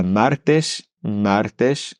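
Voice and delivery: "martes" with a rolled r before t. Shown as a demonstration. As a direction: trill the r in "martes". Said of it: "quand tu vois ces mots".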